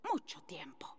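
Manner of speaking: trailing off into a whisper. Acted. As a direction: breathe the last words out. Drawn-out phrase ends in whisper.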